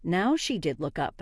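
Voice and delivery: usual speaking voice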